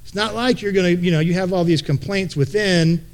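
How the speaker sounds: ordinary talk, nothing marked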